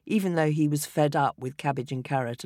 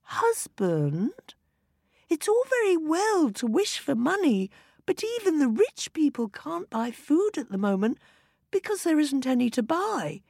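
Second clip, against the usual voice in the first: higher, eager wife voice; 11 semitones higher than usual. A narrator's reading. Wife tells husband her wish, high and eager. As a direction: yearn for children.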